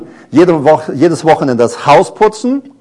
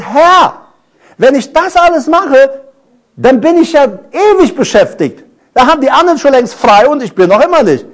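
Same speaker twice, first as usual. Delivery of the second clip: higher, whiny protest; grumbling; 7 semitones higher than usual. Retold or imitated. To the Lord, griping about scrubbing skirting boards: higher whiny grumble. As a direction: grumble that it never ends. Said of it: "Ich habe einmal gemotzt".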